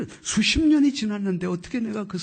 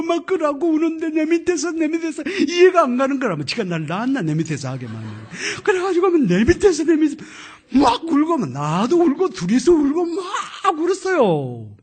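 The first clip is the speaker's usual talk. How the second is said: emotional; 11 semitones higher, 9 dB louder than usual. Retold or imitated. Both crying, laughing.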